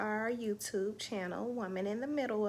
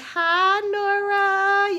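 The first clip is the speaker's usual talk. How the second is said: higher, louder, beaming hello; delighted greeting; 10 semitones higher, 12 dB louder, much slower than usual. Real, not acted. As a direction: sing out her name. Greeting her little niece.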